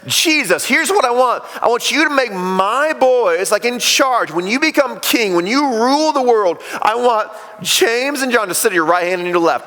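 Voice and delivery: a hint of an insistent mother lobbying for her boys; pushy mama. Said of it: "mama comes up and says".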